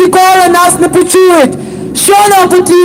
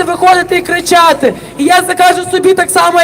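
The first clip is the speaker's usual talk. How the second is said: ordinary talk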